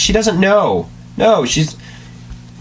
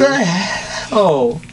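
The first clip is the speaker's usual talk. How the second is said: slow disbelief; 3 semitones higher, much slower than usual. Real, not acted. Disbelief at text.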